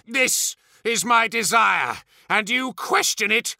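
high, incensed tyrant; raging. A narrator's reading. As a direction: rage at the one who doubts you. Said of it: "raged Fulgrim".

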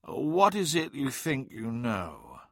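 slow, careful asking; hesitant question. A narrator's reading. Adaman to Weirdmake on what he thinks he knows, hesitant. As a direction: ask it slowly, with hesitation. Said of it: "hesitated before asking".

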